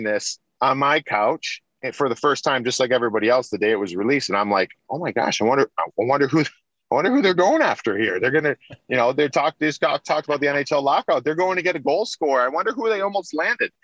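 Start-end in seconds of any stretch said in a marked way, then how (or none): 4.9-13.7 retold wide-eyed curiosity, pitched high; excited wondering. Player watching the documentary on his couch, excitedly wondering. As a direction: recreate eager guessing, voice climbing higher as it goes.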